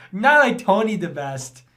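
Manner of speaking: usual speaking voice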